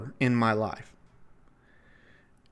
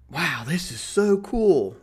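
slow, drawn-out wonder; happy awe; 5 semitones higher, slower than usual. Retold or imitated. Tourist stepping onto the Walk of Fame, slow happy awe. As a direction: savor it like a kid on a trip. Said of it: "So now I'm really happy".

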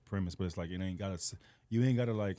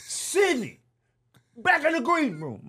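high, loud angry order; imitating mad Lee; 13 semitones higher, 5 dB louder, slower than usual. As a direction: order them back with a pitched-up yell.

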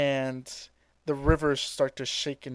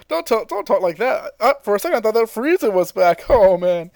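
voicing Goku; 6 semitones higher, 6 dB louder than usual. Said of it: "Goku's like".

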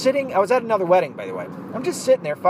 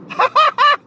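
loud high laugh; 7 dB louder than usual. Real, not acted. Telling the podcast about the tie tag, bursting out laughing.

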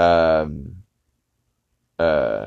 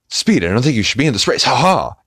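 fast, higher, overdubbed cartoon dad; Speed Racer dub; 6 semitones higher, 4 dB louder, faster than usual. Speed's father warns him off the race, fast cartoon dub. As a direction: rattle it off like a rushed old anime dub.

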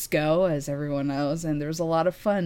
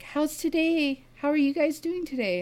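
cheerful receptionist; 11 semitones higher than usual. Retold or imitated. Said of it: "he was like".

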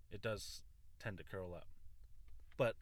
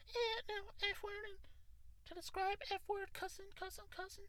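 much higher, squeaky self-mocking voice; mocking himself; 4 dB quieter than usual. High squeaky mockery of his own past rants. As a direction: squeak it like a cartoon version of himself. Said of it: "That was me".

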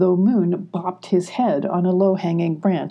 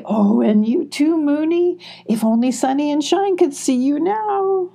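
giddy; 9 semitones higher than usual. A narrator's reading. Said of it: "squealed Molly".

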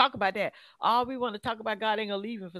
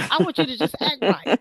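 breaking into laughter, louder; laughing; 3 semitones higher, 7 dB louder than usual. Real, not acted. Speaker answers a gift offer, laughing at their own example. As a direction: laugh your way through the words.

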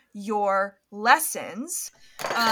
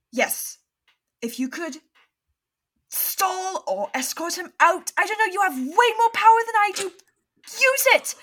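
higher and louder, rushing to a cry; frantic plea; 9 semitones higher, 5 dB louder than usual. Acted. Neville begging Hermione on the phone, high and loud. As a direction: beg her to act now.

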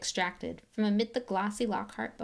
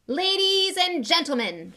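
high, loud, slow announcement; 11 semitones higher, 9 dB louder, slower than usual. Acted. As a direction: call the room to order. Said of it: "he said loudly".

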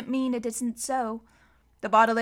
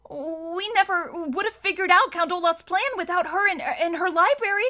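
put-on higher, louder young boy arguing; pleading boy; 7 semitones higher, 4 dB louder than usual. A narrator's reading. Klaus arguing with Mister Poe to stay. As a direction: argue earnestly for the judge.

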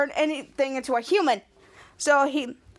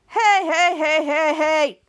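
string of raised-voice heys; imitated shouting; 4 dB louder, slower than usual. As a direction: call out to cut through the chatter, not full force. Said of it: "he got interrupted by shouting".

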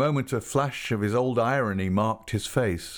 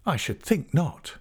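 proud, higher-pitched denial; 7 semitones higher than usual. A narrator's reading. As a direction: dismiss the idea with pride. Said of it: "she said proudly".

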